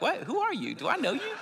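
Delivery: baffled city guy. Retold or imitated. Playing his wary Philly self, high and incredulous. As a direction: act out a suspicious double take, laughing. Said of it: "I'm like".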